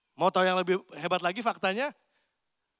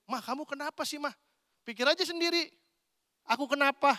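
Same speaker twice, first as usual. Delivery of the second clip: imitating a sulky wife; 8 semitones higher than usual. Husband-wife spat acted out.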